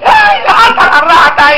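high, squealing mock panic over a rat; hammed-up scream at a rat; mock shriek. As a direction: shriek like a scared churchgoer.

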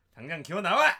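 loud barked command to crawl out; drill instructor shout. Acted. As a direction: bellow like a boot camp drill sergeant. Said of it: "지금 목소리로 굉장히 이 조교의 목소리를".

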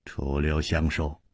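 ordinary delivery

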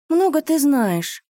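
high, slow, bitter outburst; dark and secretive. A narrator's reading. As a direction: blurt it out darkly. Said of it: "вырвалось у неё тайное, мрачное".

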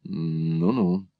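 low, soft mumble; muttering. A narrator's reading. Son gives in to his mother, muttering. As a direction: mutter it under your breath. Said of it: "пробормотал Николас".

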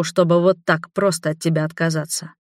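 ordinary delivery

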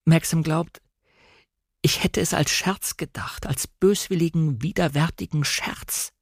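breaking, anguished confession; tearful. A narrator's reading. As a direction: choke it out, voice failing to a whisper. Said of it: "liefen mir sechzehn Stunden zu spät über die Wangen".

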